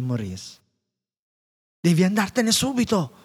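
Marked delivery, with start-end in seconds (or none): 1.8-3.2 high, urgent plea; worried father. A narrator's reading. Father urging his daughter to flee, high and anxious. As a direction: push her away in alarm. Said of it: "cercò di spiegare il padre, preoccupato".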